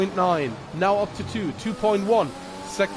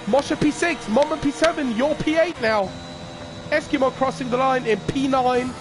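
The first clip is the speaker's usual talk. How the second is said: motorsport commentator; 5 semitones higher than usual. Real, not acted. Post-race results commentary.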